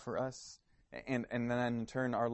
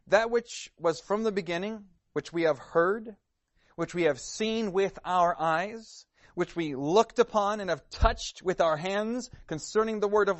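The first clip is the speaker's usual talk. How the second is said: higher, louder proclaiming read; scripture reading; 4 semitones higher, 6 dB louder than usual. Acted. Preacher reading First John to the congregation, proclaiming.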